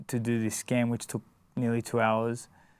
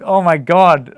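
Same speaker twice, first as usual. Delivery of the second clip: much higher, very loud oh my God; wife hearing he is cancer-free, loud overjoyed; overjoyed gasp; 6 semitones higher, 15 dB louder, slower than usual. Retold or imitated. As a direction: cry it out in sheer joy. Wife reacts to the news.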